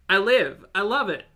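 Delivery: loud, high, gushing delight; excited. Real, not acted. Streamer gushing to chat about the chaotic baking vlog, excitedly loud. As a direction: burst out with real delight at the video.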